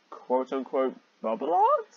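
high, slow, sarcastic; mocking quote. Real, not acted. Reader mocking the fanfic's bubble art, high and sarcastic. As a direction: sneer at the phrase.

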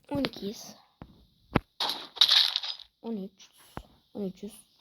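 hushed whisper. Real, not acted. Player hiding in an ambush whispers the score. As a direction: whisper it so nobody hears.

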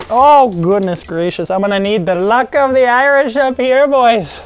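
put-on an over-the-top Irish accent. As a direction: beg the viewers for luck in a hammed-up Irish accent. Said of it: "a terrible Irish accent".

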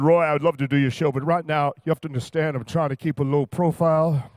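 a hint of a cool, laid-back giant; Wilt Chamberlain voice. Wilt turning down Roy's show, lightly voiced cool star.

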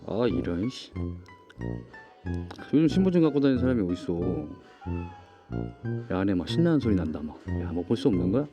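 ordinary delivery

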